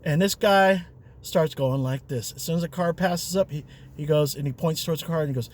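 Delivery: normal talking voice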